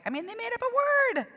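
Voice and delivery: high-pitched, laughing disbelief; scoffing laugh running through the words; incredulous laughter. Real, not acted. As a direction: burst out high, amused at the absurdity. Laughing at absurd invented term.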